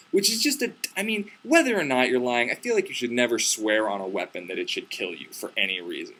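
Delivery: ordinary talk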